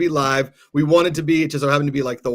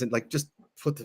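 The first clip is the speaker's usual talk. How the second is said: whispering; 2 semitones lower, 8 dB quieter, faster than usual. Real, not acted. Trails off in a whisper.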